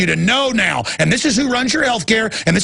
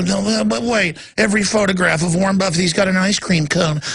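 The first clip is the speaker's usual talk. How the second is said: ordinary talk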